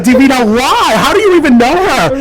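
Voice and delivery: high, loud incredulous why; shocked retort. Retold or imitated. Telling the guest, replaying a shocked protest to her drag mother.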